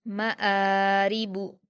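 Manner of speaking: मआरिबु slowly, quick म, long held आ; short म, then stretch आ in मआरिबु; stretched hamza. Shown as a demonstration. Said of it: "दो हरकत खींचकर अलिफ मद्दा के जैसे पढ़ेंगे".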